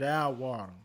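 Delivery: "Our" leaned on hard; stress on OUR. Retold or imitated. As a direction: punch "Our" to set it against "the". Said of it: "She didn't say, "The water".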